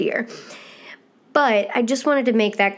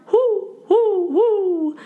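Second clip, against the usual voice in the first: high falsetto owl hoots; 11 semitones higher, much slower than usual. Shown as a demonstration. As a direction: hoot like an owl in head voice. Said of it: "Or a hoot owl".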